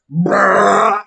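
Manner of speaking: loud rolled "brrr" burst; fire-breath roar. Acted. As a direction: imitate a blast of flame, loud and high. Imitates Baby Bark's fire breath.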